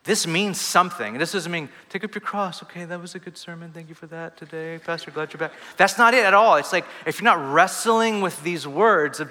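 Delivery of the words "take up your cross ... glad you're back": hushed mock pleasantries after church; mock polite churchgoer. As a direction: imitate a breezy, polite thanks to the pastor. Mimics casual churchgoer thanking pastor.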